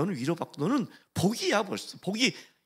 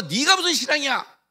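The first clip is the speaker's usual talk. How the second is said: loud, higher scornful jab; mock taunt; 8 semitones higher, 6 dB louder than usual. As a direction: sneer out the question. Believer taunts.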